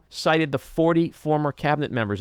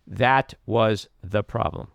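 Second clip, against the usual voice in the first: usual speaking voice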